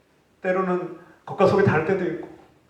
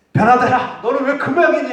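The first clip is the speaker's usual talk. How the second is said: put-on high, loud nagging at a child; mock scolding; 9 semitones higher, 7 dB louder than usual. Parent scolding a child, loud mock nagging. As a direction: scold your kid in exasperation. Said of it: "하고 책망하고 질책하면".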